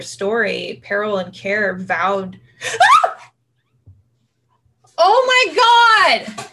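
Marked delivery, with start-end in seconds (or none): 2.6-3.1 sudden loud high gasp of fright; sharp frightened gasp. Real, not acted. Husband at window scares her. 4.9-6.4 slow, high, loud frightened cry; terrified shriek. Real, not acted. Startled by man at window.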